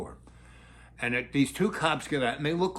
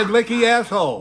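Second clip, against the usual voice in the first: loud, higher teasing echo; teasing repeat; 7 semitones higher, 8 dB louder than usual. Real, not acted. Comedian teasing the child about her phrase, loud. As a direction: toss the phrase back playfully. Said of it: "What did you say?".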